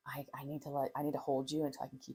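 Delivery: soft steady parent. Shown as a demonstration. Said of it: "And you say".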